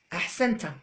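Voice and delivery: ordinary talk, nothing marked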